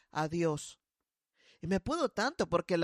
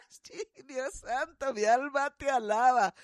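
laughing; 5 semitones higher than usual. Real, not acted. Pastora laughing hard at the mother's answer. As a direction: laugh it out to God.

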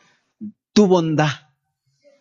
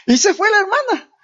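high-pitched, through laughter; amused; 13 semitones higher than usual. Real, not acted. Pastor tells congregation the sister just left, amused. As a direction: squeal it out between chuckles.

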